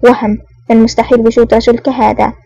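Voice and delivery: usual speaking voice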